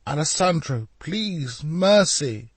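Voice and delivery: high, pleading cry; desperate plea. A narrator's reading. Leonardo pleads with knife over his heart. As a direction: plead for your life.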